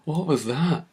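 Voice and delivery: high, incredulous question after a laugh; reliving the disbelief, pitch swooping up; stunned and giddy, half laughing; retold amazement. Retelling amazement at crossing the line.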